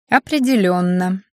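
slow, drawn-out irony; ironic. A narrator's reading. Student answering the magister, ironic. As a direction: stretch the word out with dry irony. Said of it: "иронично откликнулась девушка".

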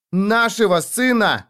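high, slow, desperate yell; a mother's raw yell, drawn out. Acted. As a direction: scream it at him in despair. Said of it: "заорала я".

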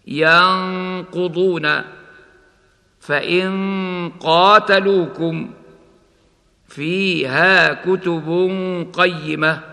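slow ينقضون, the ن held as a hum before ق; ikhfa before ق. Shown as a demonstration. Teacher demonstrating ikhfa before ق, held recitation. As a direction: recite كتب قيمة with the tanween hidden and held. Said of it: "هكذا".

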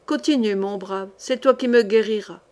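ordinary delivery, nothing marked

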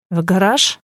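light, casual question; nonchalant. A narrator's reading. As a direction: ask as if nothing happened. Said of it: "как ни в чём не бывало". (как ни в чём не бывало)

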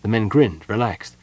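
usual speaking voice

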